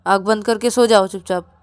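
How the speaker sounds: loud, commanding order; stern scolding. Acted. Rahul orders Ravi to sleep, stern and loud. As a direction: order them firmly, raising your voice.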